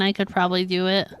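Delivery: normal talking voice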